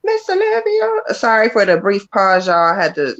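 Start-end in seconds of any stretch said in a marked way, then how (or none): 0.0-0.9 calling the name out high and sung; singsong name call. Real, not acted. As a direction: sing out her name to welcome her. Host calls out next sitter.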